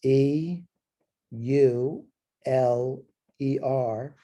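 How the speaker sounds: slow, letter-by-letter spelling; each letter drawn out on its own; spelled out. Shown as a demonstration. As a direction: spell it out slowly with pauses between letters. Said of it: "Euler is, uh, spelled".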